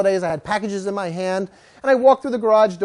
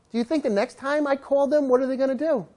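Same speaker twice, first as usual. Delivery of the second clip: ordinary delivery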